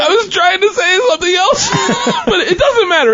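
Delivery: high squeal through real laughter; very high, laugh-choked voice; laughing protest. Real, not acted. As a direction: defend yourself while cracking up. Laughing defence of his mistake.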